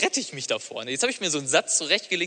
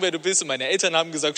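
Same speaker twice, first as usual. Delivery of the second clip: usual speaking voice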